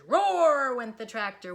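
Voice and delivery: very loud, slow roar; roaring sound word. A narrator's reading. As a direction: make the roar big. Narrated tractor sound.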